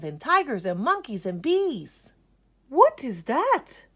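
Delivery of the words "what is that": high, loud, slow question; curious teacher. Acted. Art teacher asks Ned about his drawing. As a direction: ask it slowly and curiously up high. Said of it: "asked the teacher".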